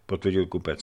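ordinary delivery

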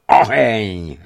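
a loud, menacing bark of a single word; threatening shout. A narrator's reading. Governor threatens the tortured prisoner, loud and menacing. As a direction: hurl the order like a threat. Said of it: "zvolal výhružně".

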